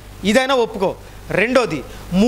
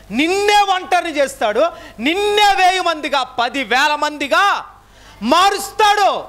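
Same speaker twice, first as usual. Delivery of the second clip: rising sermon; 6 semitones higher than usual. Acted. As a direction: lift the congregation, voice climbing. Raised preaching on loneliness. (ఉచ్చ స్వరంలో బోధన)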